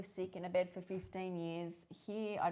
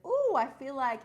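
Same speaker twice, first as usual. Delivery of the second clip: excited craving; 7 semitones higher, 9 dB louder than usual. Retold or imitated. Acted craving quote.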